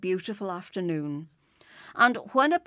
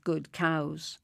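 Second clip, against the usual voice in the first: ordinary delivery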